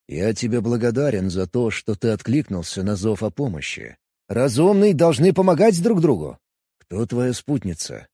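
cool, calm alien; Vulcan voice. Acted. Vulcan Kos keeps speaking.

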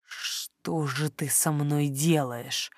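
very soft, low and slow; intimate whisper. A narrator's reading. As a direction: murmur it slowly into the ear. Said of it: "шепча в самое ухо".

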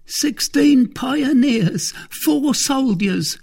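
very high, slow, loud servant; Grimaud's character voice. Acted. Grimaud counts the approaching troop for Athos, high and slow. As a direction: count them off slowly, high-pitched.

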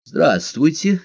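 mildly bashful phone greeting; polite, slightly embarrassed. A narrator's reading. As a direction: greet a stranger politely, a little sheepish. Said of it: "произнёс вежливый, немного смущённый голос".

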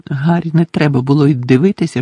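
usual speaking voice

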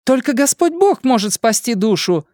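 high fervent preaching cry; zealous outburst. Acted. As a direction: proclaim it fervently at him. Protester preaches at him.

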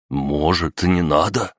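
ordinary delivery, nothing marked

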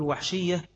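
وحشية said high and pointed; single out the word وحشية as the key term; stress on وحشية. Shown as a demonstration. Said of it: "هنا يعني بيحترز".

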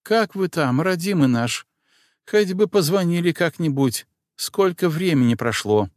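put-on high, fussing old housekeeper; an anxious, doting old nanny; old woman voice. A narrator's reading.

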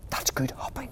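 soft admiring whisper; whispered thought. Acted. Little hare admiring his father's hop, whispered thought. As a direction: whisper it in awe. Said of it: "thought Little Nut Brown Hare".

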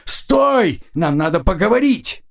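yell. A narrator's reading. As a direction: shout him to a stop. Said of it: "заорала она во всё горло".

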